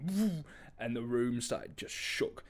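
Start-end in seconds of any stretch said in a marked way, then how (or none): 0.1-0.4 slow "woo" for a bass hit; imitated bass drop. Describing the bass hitting the room, imitated boom. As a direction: mimic the whoosh of bass hitting. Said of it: "it just went".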